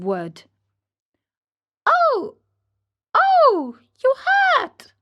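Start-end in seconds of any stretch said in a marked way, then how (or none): 1.9-4.9 very high, loud little yelps; a small voice crying in pain; tiny voice crying out. A narrator's reading. Wood's tiny voice cries out at the blow, very high. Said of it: "cried the same faraway little voice".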